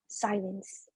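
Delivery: ordinary talk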